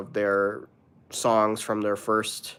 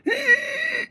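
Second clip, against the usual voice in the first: a sudden, very high hammed-up mock wail; mock cry on cue; fake crying wail; 3 dB louder than usual. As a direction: burst into a pretend sob, way over the top. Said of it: "Let's try crying. Ready?".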